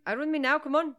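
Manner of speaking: hammed-up Irish brogue; Irish accent. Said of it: "read the dialogue in an Irish accent".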